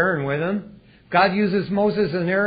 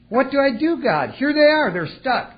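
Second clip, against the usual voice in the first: panicked plea; 9 semitones higher, 4 dB louder than usual. Pretend. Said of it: "people voiced in panic".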